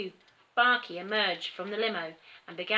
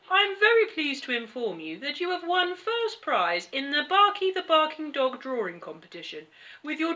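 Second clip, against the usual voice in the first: lightly voiced announcer tone, higher; formal chauffeur; 7 semitones higher, 4 dB louder than usual. A narrator's reading. Chauffeur informing Terry of his prize, lightly formal. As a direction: announce it like an official prize notice. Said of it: "said the chauffeur".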